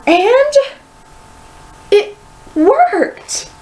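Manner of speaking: gasping high with wonder; breathless high surprise; amazed gasp. A narrator's reading. Class gasps at tamed hair, high and amazed.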